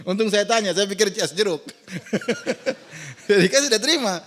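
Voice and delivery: chuckles break through the words; laughing while telling. Real, not acted. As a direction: tell it laughing at yourself. Laughing at his plane story. (cerita sambil tertawa geli)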